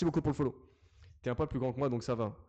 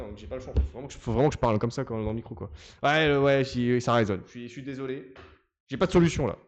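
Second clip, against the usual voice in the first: normal talking voice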